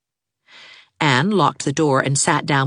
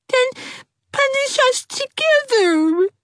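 starting to cry; slower than usual. A narrator's reading. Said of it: "beginning to cry".

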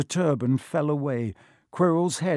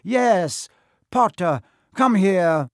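loud, raised villain's order; commanding; 6 semitones higher, 8 dB louder, slower than usual. A narrator's reading. Quirrell orders Harry to come to him, commanding. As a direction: call him over sharply and loudly.

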